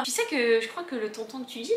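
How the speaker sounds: ordinary delivery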